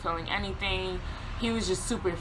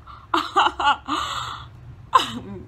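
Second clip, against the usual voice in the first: loud, falling burst of incredulous laughter; 7 semitones higher, 5 dB louder than usual. Retold or imitated. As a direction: laugh it off in disbelief. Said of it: "I started laughing".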